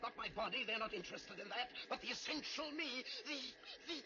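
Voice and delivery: urgent panic building to a cry; desperate. Acted. Professor begs John to shoot him, rising panic.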